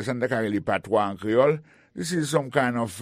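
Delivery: normal talking voice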